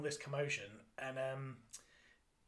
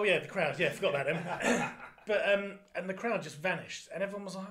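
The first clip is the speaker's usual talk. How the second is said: normal talking voice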